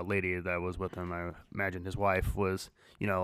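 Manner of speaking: ordinary talk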